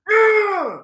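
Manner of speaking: high tearful victory cry; crying shout. Real, not acted. Fan crying over the race win, tearful shout. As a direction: yell it through real tears. Joyful tears after win.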